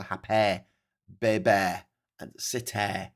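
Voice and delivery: put-on "happy, baby, city" ending in a falling eh. Shown as a demonstration. Presenter showing working class Manchester vowels, "happy" ending in eh. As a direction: turn the final y of "happy" and "baby" into eh. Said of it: "more likely to use a".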